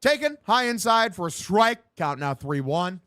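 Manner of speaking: live baseball commentary; punchy sportscaster delivery; play-by-play. Acted. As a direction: call the pitch like a radio announcer.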